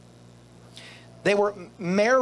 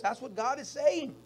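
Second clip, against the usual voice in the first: ordinary talk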